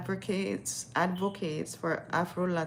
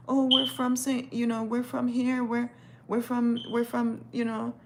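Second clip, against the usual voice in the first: high, louder halting put-on voice; imitated newcomers; 6 semitones higher, 3 dB louder than usual. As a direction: stumble through it in a high mock voice. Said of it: "and they're like".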